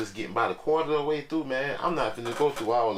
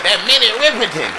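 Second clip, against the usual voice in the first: a light mock rapper shout-out, higher and louder with a laugh; 11 semitones higher, 11 dB louder than usual. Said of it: "used to be like".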